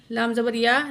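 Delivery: ordinary talk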